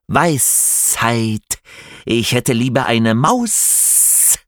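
slow snake lisp, unimpressed; a scoffing serpent; slushy lisp. A narrator's reading. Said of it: "lispelte die Schlange".

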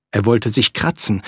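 plain reading voice

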